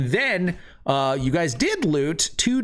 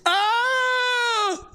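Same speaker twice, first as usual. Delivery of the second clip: very high, sustained scream. Acted. Game master as startled mage, high scream. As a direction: shriek far above your usual pitch. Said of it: "he screams".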